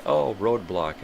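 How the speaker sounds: ordinary talk, nothing marked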